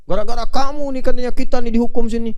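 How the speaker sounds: loud, high-pitched mock blaming; acted accusation. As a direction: play the victims angrily blaming the devil. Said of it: "dituntut kan sama orang-orang yang kena".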